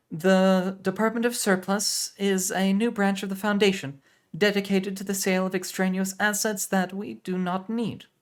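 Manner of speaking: high, loud and curt. Acted. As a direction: clip it short and businesslike. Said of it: "she said curtly".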